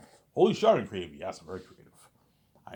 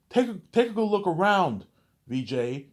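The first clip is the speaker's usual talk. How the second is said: higher and louder, furious; yelling; 12 semitones higher, 10 dB louder than usual. Retold or imitated. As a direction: shout him down in the argument. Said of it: "You see Michael Jordan, like, yelling".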